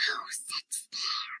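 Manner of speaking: hushed unvoiced whisper. Julie whispering to David about the giant chair. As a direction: whisper it so no one hears. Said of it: "whispered Julie".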